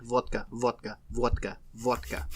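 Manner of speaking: deep, soft Russian man voice; Russian accent. Retold or imitated. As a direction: chant vodka like a Russian man. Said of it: "I talk like a Russian man".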